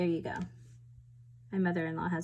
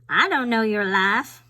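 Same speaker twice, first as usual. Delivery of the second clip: louder, hammed-up Southern twang; playful Southern accent; 2 semitones higher, 8 dB louder than usual. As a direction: throw it out in a big mock Southern accent. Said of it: "a Southern accent".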